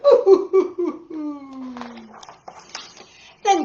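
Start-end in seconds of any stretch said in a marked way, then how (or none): 0.0-2.1 loud high boo-hoo fading off; hammed-up crybaby wail; mock crying. Reading to kids, the little boy's playful sob. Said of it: "the little boy went".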